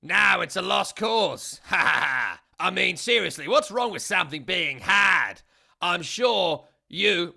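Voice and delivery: loud, slow, exasperated press-conference rant; Ange Postecoglou. Retold or imitated. Manager lectures a reporter at the press conference, loud and slow. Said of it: "And he said, quote".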